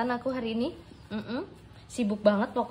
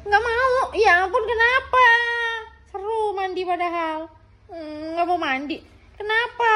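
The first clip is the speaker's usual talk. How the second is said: put-on whiny baby refusal; 8 semitones higher, 7 dB louder than usual. Baby voiced refusing the bath.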